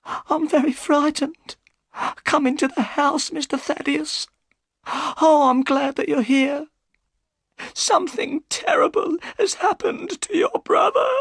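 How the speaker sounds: put-on high, pleading old woman's voice; a scared servant begging for help; frightened old housekeeper. A narrator's reading. I'm very frightened.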